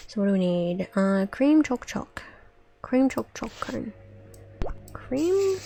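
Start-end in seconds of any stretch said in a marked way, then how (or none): 4.9-5.6 high, startled question; surprised, high-pitched check. Acted. Questioning the order.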